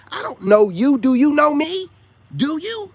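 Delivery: loud, high challenge to a stranger; retold confrontation.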